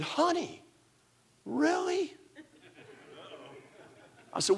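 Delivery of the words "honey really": high, soft mock wife voice; disbelieving wife. Wife questioning her husband's mess, in a high disbelieving voice. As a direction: let the disbelief hang.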